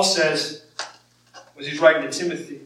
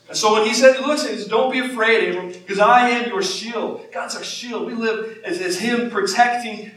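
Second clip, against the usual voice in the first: higher, raised pulpit voice; impassioned preaching; 6 semitones higher, 5 dB louder than usual. Real, not acted. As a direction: proclaim it with rising force.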